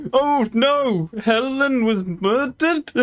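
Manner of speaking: high, slow mock-Scottish lament; mock dismay in a Scottish accent. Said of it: "I don't know why I went Scottish there".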